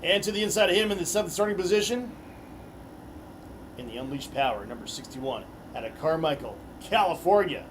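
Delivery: arena driver introductions; announcer. Acted. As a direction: hype each name for the crowd.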